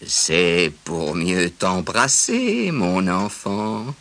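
very slow, sly big bad wolf. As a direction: stretch it out like a scheming wolf. Wolf answers the girl.

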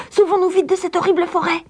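put-on high, fast urgent girl; urgent Gretel. A narrator's reading. Gretel urging escape from the forest, fast high girl. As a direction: hurry her brother away. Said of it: "cria-t-elle".

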